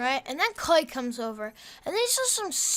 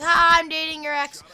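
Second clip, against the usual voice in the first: taunting; 8 dB louder than usual. Retold or imitated. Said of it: "rubbing it in Clay's face".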